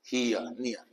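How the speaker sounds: हियर, नियर softly, with the "ee-uh" glide; low, quiet model of "ee-uh" in हियर, नियर; ear-sound demo. Shown as a demonstration. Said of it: "ईयर की आवाज आई". (हियर, नियर में "इय" धीमी, नीची आवाज़ में)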